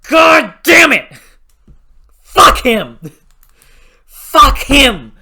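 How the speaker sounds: loud, high yelled curses; angry shouting. Real, not acted. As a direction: let the anger explode again. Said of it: "I haven't been this angry".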